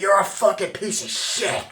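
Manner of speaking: loud, high shouted insults; furious yell. Retold or imitated. Retelling DiCaprio yelling at the phone operator, furious yell. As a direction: blow up at the man on the phone.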